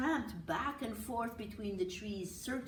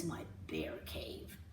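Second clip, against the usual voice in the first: breathy mock bear growl, barely voiced; playful little-bear growl; 5 dB quieter than usual. Boy in bear suit claims his cave, breathy play growl. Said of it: "growled Uli".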